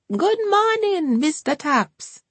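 high and slow sing-song welcome; Hortense greeting. A narrator's reading. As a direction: draw the greeting out high and sweet.

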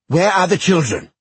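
loud, high demanding yell; angry shout. Acted. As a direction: demand answers furiously. Will demanding.

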